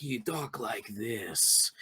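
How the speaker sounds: slow, hushed imitation of a sinister seducer; diabolical, seductive. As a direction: purr it low and very drawn out. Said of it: "sound diabolical yet somewhat seductive".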